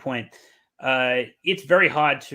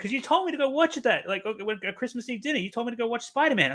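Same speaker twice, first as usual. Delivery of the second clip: indignant friend; 9 semitones higher than usual. Retold or imitated. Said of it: "And he's like".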